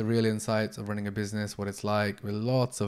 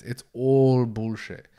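ordinary talk

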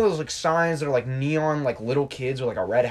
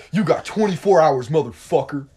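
loud, higher retold threat; imitated yelling; 3 semitones higher, 7 dB louder than usual. Angry man gives Trey a deadline, imitated yelling. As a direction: act out an angry man's ultimatum. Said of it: "he's, like, yelling at Trey".

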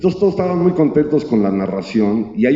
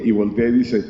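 usual speaking voice

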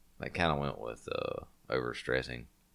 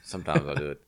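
usual speaking voice

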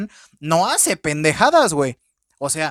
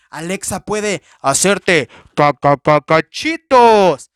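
mock menacing Alexa; 3 semitones lower, 7 dB louder, much slower than usual. Mock threat from killer Alexa.

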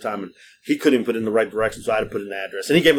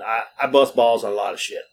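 usual speaking voice

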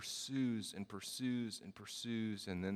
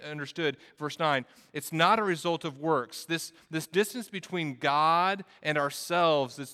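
raised, higher-pitched sermon delivery; impassioned preaching; 7 semitones higher, 7 dB louder than usual. Real, not acted. Preacher telling the church of God's grace, impassioned preaching. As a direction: preach it out with energy and emphasis.

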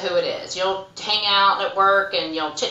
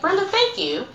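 grateful coworker; 12 semitones higher than usual. Retold or imitated. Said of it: "she's like".

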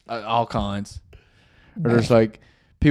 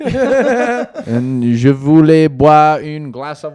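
mock Acadian Franglais; 5 dB louder, slower than usual.

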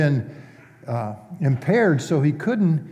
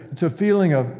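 ordinary talk

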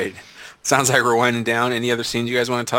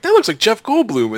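surprised; 10 semitones higher, 3 dB louder than usual. Retold or imitated. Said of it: "I'm like".

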